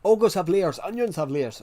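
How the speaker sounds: a rough attempt at a Scottish ogre accent; Shrek. Quoting the film ogre, badly faked Scottish accent. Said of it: "I can't do his accent".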